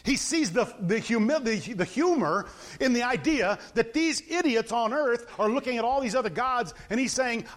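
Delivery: usual speaking voice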